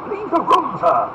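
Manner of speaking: high, loud command; ringing lordly order; furious dismissal. Acted. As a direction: order him out as an outraged Duke. Duke orders officer out.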